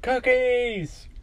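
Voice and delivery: high, drawn-out gleeful squeal; delighted cry. Acted. Excited character sees a cookie.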